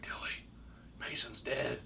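soft, half-whispered retold news; hushed report. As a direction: break bad news under your breath.

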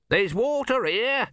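high, drawn-out shout without much volume; mild shout. A narrator's reading. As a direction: call it out high and slow. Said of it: "shouted Om".

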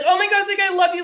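high, loud excited gushing at a player; retold tearful gush. Fan meets her favorite former Ranger, gushing high and loud. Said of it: "And I literally start crying".

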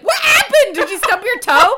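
high, loud alarmed squeal; startled roommate. Retold or imitated. Mimicking her girlfriend rushing in alarmed. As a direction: act out the roommate's shocked cry. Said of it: "I screamed so loud that Brittany was like".

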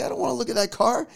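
high, quick mock protest; imitated reluctant wife. Wife refusing husband's car trip, imitated protesting tone. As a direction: put on a wife's high-pitched refusal. Retelling wife's reluctant reply.

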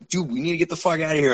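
fast, urgent get us out; hurried urging. Retold or imitated. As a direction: rush your friend away. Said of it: "the person I was with".

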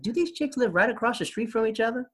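high, incredulous question; mock disbelief. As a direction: act amazed they're always together. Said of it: "And I was like".

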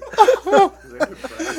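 big loud cackle high up; loud high laugh. Real, not acted. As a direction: burst out laughing. Punchline of mom story.

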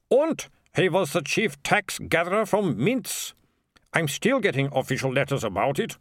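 lightly voiced, weary fussy mayor; a hint of a harassed official; exasperated mayor. Mayor complaining about daughter.

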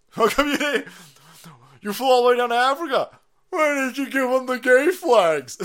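loud taunting questions; loud mocking jeer; mocking shout. Real, not acted. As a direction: yell the questions at her, sneering.

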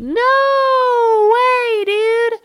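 very high, loud falsetto wail; hammed-up drawn-out shriek of refusal; emphatic refusal. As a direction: wail it way up high, stretched long. Mock refusal to do retakes.

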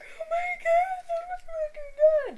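hammed-up falsetto raving; mock gushing. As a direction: put on a squeaky, over-the-top fake rave. Mocking her reaction to the engagement.

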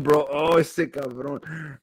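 normal talking voice

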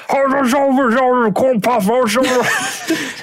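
high, garbled mock rant; hammed-up high-pitched gibberish tirade; mock yelling. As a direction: yell nonsense at the room, far up in pitch. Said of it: "start yelling at the room".

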